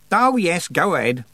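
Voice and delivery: high, mocking goddess voice; sardonic goddess. A narrator's reading. Goddess watching a girl rattle a stuck drawer, sardonic. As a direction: needle them with sarcasm from behind.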